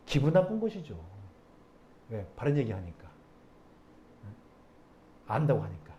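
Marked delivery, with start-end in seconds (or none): none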